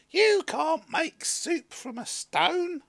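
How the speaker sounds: high, loud and slow scoffing woman; woman's voice. A narrator's reading. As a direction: spell out her disbelief slowly and high. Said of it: "the woman laughed".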